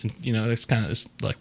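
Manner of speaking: ordinary talk, nothing marked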